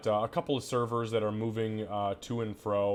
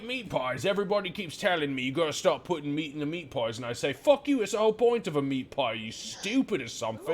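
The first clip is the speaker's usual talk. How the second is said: higher, louder, brash barkeep rant; half-orc barkeep mocking his critics; rowdy barkeep; 7 semitones higher, 4 dB louder, faster than usual. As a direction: rant like a cocky tavern owner. GM voicing the barkeep.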